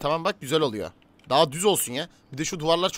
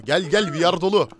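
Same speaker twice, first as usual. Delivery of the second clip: loud, raised customer-hawking call; 4 semitones higher, 9 dB louder than usual. Acted. Game cafe owner waving customers in, calling out. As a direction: call the customers in, louder and higher.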